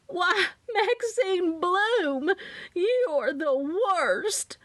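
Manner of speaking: high, drawn-out Southern screech; hammed-up shriek without much added volume; theatrical scream. A narrator's reading. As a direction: wail the insult in a Southern accent. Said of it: "Loretta screamed at the top of her lungs".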